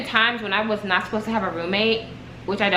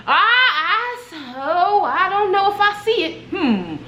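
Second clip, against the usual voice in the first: higher-pitched, hesitant mock voice; jail man; 7 semitones higher, slower than usual. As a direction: imitate the man hemming and hawing. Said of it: "the jail man is like".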